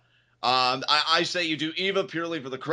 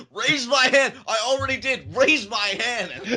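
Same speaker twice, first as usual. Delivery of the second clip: high, loud bossy yelling; bully the referee into it; bullying demand; 9 semitones higher, 4 dB louder, slower than usual. Retold or imitated. Alexa bullying the ref after the win, loud mock yells. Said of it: "she bullied the ref".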